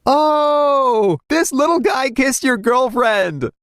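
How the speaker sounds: put-on high shouted taunt, slow on the girlfriend line; crowd taunting. A narrator's reading. Narrator voices schoolyard crowd mocking the bully, high shout. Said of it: "crowd taunting the bully".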